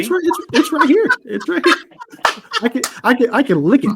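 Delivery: high-pitched, half laughing; high protest. Real, not acted. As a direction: squeak out a defence. Protesting mic distance, laughing.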